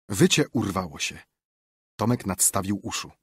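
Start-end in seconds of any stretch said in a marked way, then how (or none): none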